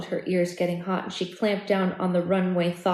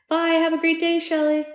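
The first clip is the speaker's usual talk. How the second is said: cheerful goodbye; 8 semitones higher, 4 dB louder than usual. Real, not acted. Greets someone in the room.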